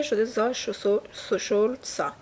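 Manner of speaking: usual speaking voice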